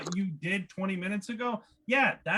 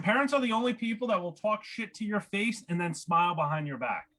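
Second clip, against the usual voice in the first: ordinary talk, nothing marked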